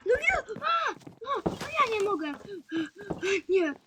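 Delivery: dismayed outcry. Real, not acted. Mob blows up his base.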